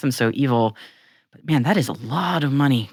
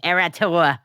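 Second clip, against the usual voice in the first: put-on playful New England accent, Era Tour; 4 semitones higher, slower than usual. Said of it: "in a New England accent".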